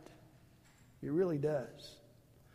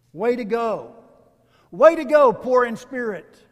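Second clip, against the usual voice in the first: loud, high cheer of congratulations; rousing congratulatory shout; 4 semitones higher, 12 dB louder than usual. Acted. Preacher cheering the poor in spirit.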